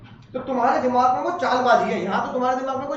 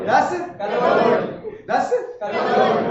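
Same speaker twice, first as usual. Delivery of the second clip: put-on laughing child; much slower than usual. Shown as a demonstration. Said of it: "हाँ, कलोल।".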